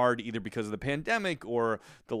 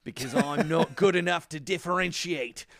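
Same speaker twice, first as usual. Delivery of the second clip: put-on amateur New Zealand accent drifting toward Australian. Host warning listeners about his attempt, shaky New Zealand accent. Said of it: "do my best New Zealand accent".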